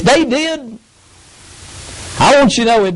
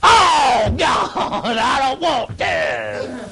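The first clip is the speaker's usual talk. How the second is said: grudging churchgoer; much slower than usual. Retold or imitated. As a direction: moan it like a man who'd rather stay in bed. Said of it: "woke up and went".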